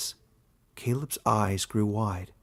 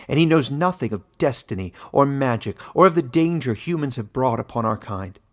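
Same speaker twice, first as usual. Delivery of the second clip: louder, growled accusation; 3 semitones higher, 7 dB louder than usual. A narrator's reading. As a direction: snap it out like a wolf. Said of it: "she growled".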